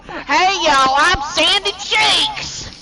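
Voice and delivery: loud, slow, high Texan twang; Sandy Cheeks southern accent. Retold or imitated. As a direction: ham up a heavy southern accent. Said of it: "You had such a heavy southern accent".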